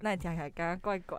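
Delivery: muffled by a mask; talking behind a mask; through a face mask. Real, not acted. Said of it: "我们戴着口罩录音".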